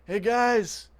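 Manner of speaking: put-on high, sing-song call to the kids; sweet calling out. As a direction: lure them in with a sugary hello. Luring the kids into trap.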